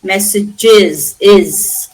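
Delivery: MESSAGES slowly, then IS echoing its last syllable; extra plural syllable. Shown as a demonstration. Teacher shows what we are adding, extra "iz" syllable. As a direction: stretch MESSAGES and isolate the ending as IS. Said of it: "we are adding an H".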